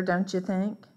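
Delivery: ordinary delivery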